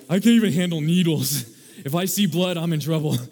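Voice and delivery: loud, laughing mock squeamish voice; squeamish listener. Pastor mimicking the congregation's fear of needles, loud and laughing. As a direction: play someone who faints at blood, hammed up. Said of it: "A lot of you are like".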